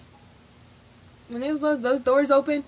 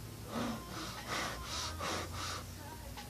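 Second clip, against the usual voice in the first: long, breathy mock gasps; re-enacted stunned gasping; 15 dB quieter than usual. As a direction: gasp over and over in disbelief. Stunned reaction to surprise tickets.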